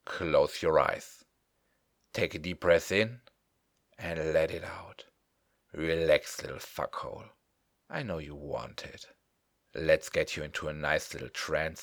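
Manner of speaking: intimate close whisper; right in your ear. Acted. Breath cue, drops close.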